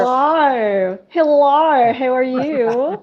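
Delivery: hammed-up Australian greeting, slow on how are you; mock Australian accent doing a friendly hello; awful Australian accent. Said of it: "It's my awful Australian accent".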